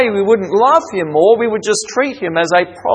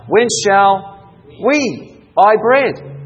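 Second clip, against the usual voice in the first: slow reading, WE set apart and punched; stress on WE; 4 semitones higher, much slower than usual. Shown as a demonstration. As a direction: hit "we" hard, pausing round it. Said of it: "what's the next word?".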